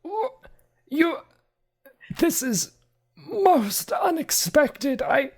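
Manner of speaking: high, slow, halting butler voice; a prim man lost for words; high, stammered, slowly stretched shock; sputtering butler. Acted. Archimedes sputters.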